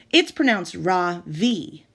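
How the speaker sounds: "Ra-V" very slow, soft Ra and strong V; careful pronunciation. Shown as a demonstration. Ravi explaining how his name is truly said, slowly. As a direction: lay out "Ra-V" slowly, stressing the V. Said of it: "with a soft Ra and a strong V".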